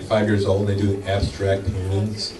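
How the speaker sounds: ordinary talk, nothing marked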